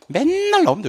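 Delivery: "맨날" stretched out high; high, insistent "맨날"; emphatic. Real, not acted. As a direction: draw out "맨날" on a high pitch. Teacher stressing a frequent exam topic.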